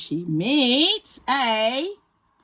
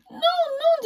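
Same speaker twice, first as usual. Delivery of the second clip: high squeaky no; galette's playful refusal; galette refusing. Acted. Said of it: "dit la galette".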